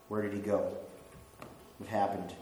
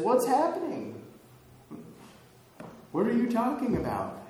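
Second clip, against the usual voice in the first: Jesus playing innocent; 10 semitones higher, 3 dB louder than usual. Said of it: "Jesus joins up with them and says".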